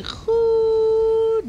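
high, slow, sung-out Dutch hello; showy Dutch greeting. Acted. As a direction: stretch the greeting out like a showman. Stream opening greeting.

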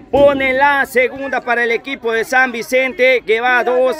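high, slow, savoring the goal; excited commentary. Real, not acted. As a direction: linger on every word of the goal. Celebración tras el gol. (narración alegre, aguda y pausada)

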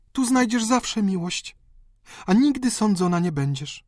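tender sister's promise; consoling sister. Acted. Sister consoles the weeping countess.

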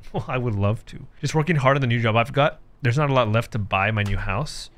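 ordinary talk, nothing marked

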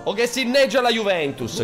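high, loud exaggerated proclamation; theatrical rant. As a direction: declaim it like a pompous rule-maker. Theatrical rant on ban rules. (proclama teatrale ed esagerato)

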